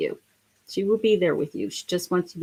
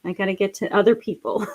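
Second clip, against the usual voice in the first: usual speaking voice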